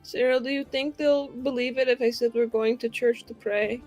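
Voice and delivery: ordinary talk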